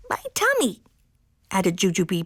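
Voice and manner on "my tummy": high-pitched little kitten voice; kitten interrupting. A narrator's reading. Full kitten interrupts mama's reading, high little voice. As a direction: pipe up high, cutting into the story.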